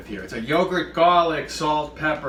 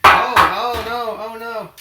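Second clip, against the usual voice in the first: high, slow cry of oh no; mock alarm; 8 semitones higher, 8 dB louder, much slower than usual. Real, not acted. Host flipping meatloaf, sudden mock panic. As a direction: fake panic as the loaf flips.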